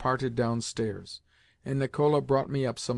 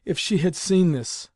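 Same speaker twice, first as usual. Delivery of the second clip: higher, louder lament, "seen" stressed; tearful exclamation; 5 semitones higher, 5 dB louder than usual. A narrator's reading. Grandmother wiping away her tears, heightened tearful lament. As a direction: let the dismay climb, pitched up on "seen". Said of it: "wiping away her tears".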